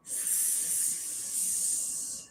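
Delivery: soft unvoiced hiss like a little snake. Shown as a demonstration. Teacher shows the class the snake hiss, very soft. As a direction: let out a quiet snake sound. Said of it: "we're gonna be a little snake".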